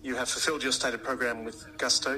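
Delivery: normal talking voice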